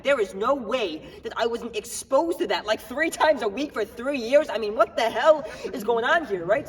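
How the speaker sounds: acted protest, pushing hard on the key words; agitated rant. Man insisting to cops he should have it, agitated.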